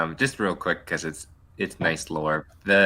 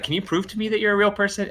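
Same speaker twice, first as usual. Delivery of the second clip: pleading quote; 13 semitones higher, faster than usual. Retold or imitated. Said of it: "I was like".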